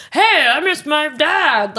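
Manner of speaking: high, slow, dazed mock voice; mock groggy plea. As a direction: drag it out like a drugged patient. Imitating herself under anesthesia.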